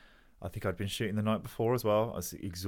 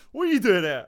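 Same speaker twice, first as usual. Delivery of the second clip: the manager, high and loud with surprise; much higher and louder startled manager; surprised manager; 14 semitones higher, 14 dB louder than usual. Retold or imitated. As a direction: play the club manager caught off guard. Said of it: "He was like".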